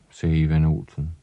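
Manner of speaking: usual speaking voice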